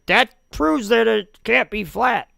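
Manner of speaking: high, slow, sneering caricature of a globe believer; mocking a critic's stock argument in a high, slow voice; mocking opponent. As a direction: parrot the argument in a silly high voice. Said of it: "another stupid argument I get all the time".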